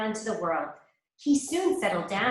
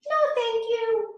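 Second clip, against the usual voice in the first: high, polite pig voice; polite little pig; 14 semitones higher than usual. A narrator's reading. Pig politely refusing the wolf, high. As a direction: turn the wolf down sweetly as the pig. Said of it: "said the pig politely".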